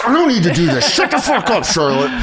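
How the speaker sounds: high laughing comeback; laughing retort. Real, not acted. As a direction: snap back, laughing. Retort to mocked Charlotte.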